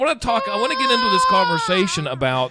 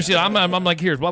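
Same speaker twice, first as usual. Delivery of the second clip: normal talking voice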